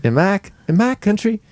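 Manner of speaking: put-on echoing a very high Southern accent; imitated high, tight Southern accent; high-pitched Southern.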